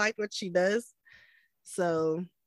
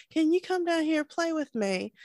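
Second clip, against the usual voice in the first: higher-pitched imitated Aussie-British kid; Australian-British child; 7 semitones higher than usual. Mom imitates son asking her to play, Australian-British accent. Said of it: "got an Australian and British accent".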